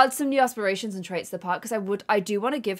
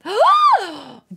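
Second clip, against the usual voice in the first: sharp gasp; 9 semitones higher, 7 dB louder than usual. Real, not acted. Shocked by sim's gibberish.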